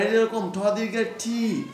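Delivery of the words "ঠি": "ঠি" with a long, held "ee"; long vowel. Shown as a demonstration. Teacher explains the long vowel sign, "ঠি" with a drawn-out "ee". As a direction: stretch the vowel of "ঠি" slowly.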